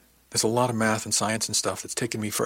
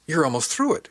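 surprised exclamation, pitched high; exclaiming; 7 semitones higher, 4 dB louder than usual. A narrator's reading. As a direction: burst out in amazement. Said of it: "she exclaimed".

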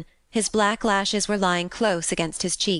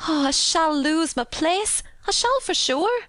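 distressed; 10 semitones higher than usual. A narrator's reading. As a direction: wail it fretfully in Yorkshire dialect. Maid laments losing her job.

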